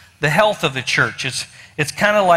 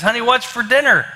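higher-pitched call to someone nearby; acted call, raised in pitch; 6 semitones higher than usual. Husband ten feet behind his wife, calling out.

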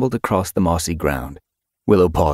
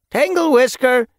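calling out; 5 dB louder than usual. A narrator's reading. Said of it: "she called up to the Elder's Den".

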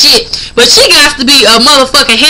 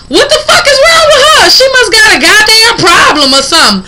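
retold shout; 7 semitones higher than usual. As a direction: yell it as if outraged across the parking lot. Retelling a quarrel with a stranger.

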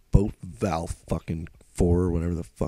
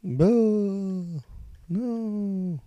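dismayed outburst; 12 semitones higher than usual. Real, not acted. Ship leaves without him.

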